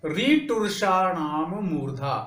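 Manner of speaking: slow, deliberate sutra recitation; reciting the sutra. Shown as a demonstration. Teacher recites the sutra slowly to the class. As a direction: lay out रीटुर्षाणाम मूर्धा slowly, syllable by syllable. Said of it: "तो मूर्धा का सूत्र क्या है".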